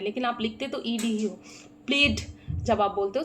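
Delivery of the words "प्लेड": प्लेड ending in a voiced D; D sound at end. Shown as a demonstration. Teacher showing learners the past-tense ending, प्लेड said with final D. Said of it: "last में डी का sound आ रहा है".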